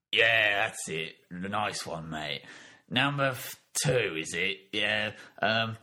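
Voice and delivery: bossy East End deity giving dictation; Cockney God. Acted. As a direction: punch each rule heading, then drop into offhand muttering. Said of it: "Cockney voice, it's God".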